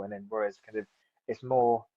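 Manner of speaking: normal talking voice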